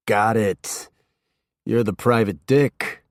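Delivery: amused, lazy recognition; Norman's voice. A narrator's reading. Stoned host Norman sizing up the detective, amused.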